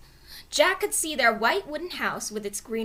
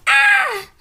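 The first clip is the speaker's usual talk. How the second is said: very high loud scream; 12 dB louder than usual. A narrator's reading. Annie screaming at the monster outside, scream. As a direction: shriek in fright. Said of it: "screamed Annie".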